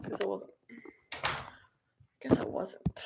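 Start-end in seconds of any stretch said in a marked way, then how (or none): 2.2-3.0 whispered repeat. Real, not acted. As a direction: Guess I wasn't whispered again, soft and rueful. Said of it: "I thought I was so organized".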